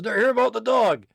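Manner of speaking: loud call over the shoulder; man calling back. Acted. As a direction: holler back to someone in another room. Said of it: "He turns around and says".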